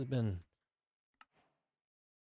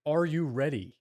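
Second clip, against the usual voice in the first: louder, teasing the audience; hype question; 3 semitones higher, 6 dB louder than usual. Real, not acted. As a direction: build the hype. Hyping the first mukbang.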